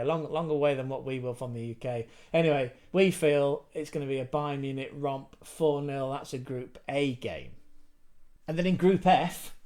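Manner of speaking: normal talking voice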